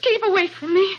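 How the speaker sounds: she's cornered and panicking; terrified plea. Acted. Dorothy cornered by Rolfe at the door, high and terrified.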